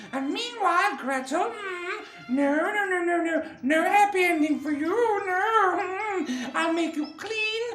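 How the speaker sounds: very high, bossy witch voice; bad witch. A narrator's reading. Witch setting Gretel to work, nasty witch. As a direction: order her about in a high witch voice. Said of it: "because I'm a bad, bad witch".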